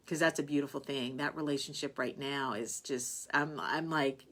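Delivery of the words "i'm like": ordinary talk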